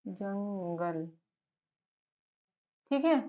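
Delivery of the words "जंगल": जंगल drawn out slowly and much softer; slow soft demo. Shown as a demonstration. Teacher quietly modelling जंगल, slow and soft. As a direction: breathe जंगल out slowly for the learner. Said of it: "कैसे".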